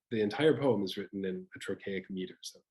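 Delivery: ordinary talk, nothing marked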